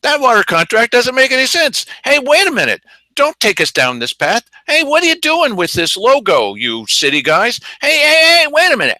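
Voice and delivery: caricatured citizens crying foul; mock protesting citizens. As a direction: play the fed-up voters objecting. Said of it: "people who are saying".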